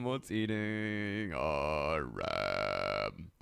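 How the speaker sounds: very slow, low and stretched; slowed-down mock drawl; drawn-out slow take. Shown as a demonstration. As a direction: drag every word out lazily. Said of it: "repeats the line twice".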